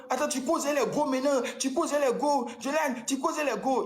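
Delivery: high, slowing imitated yell; hammed-up jealous shouting; mock yell. Ex-girlfriend yells accusations in the hotel, imitated high. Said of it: "Tu as crié".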